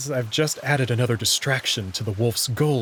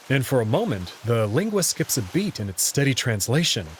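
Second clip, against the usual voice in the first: ordinary delivery, nothing marked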